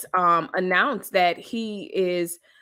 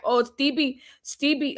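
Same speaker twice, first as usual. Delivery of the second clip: a light put-on version of her accent, pitched higher; Jocelyn's accent; 7 semitones higher than usual. Imitating a woman calling to Stevie, in a light put-on version of her accent. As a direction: call his name lightly in her accent, half-hearted. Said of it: "I won't do her accent".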